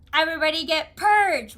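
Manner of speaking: playful, high-pitched holler; playful battle cry. Pretend. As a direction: call it out to the whole crowd. Play-acting the game's purge.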